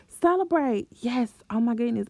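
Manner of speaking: high, loud burst of joy; excited cheer. Acted. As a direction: cheer it out like a party starter. Cheering on a small win.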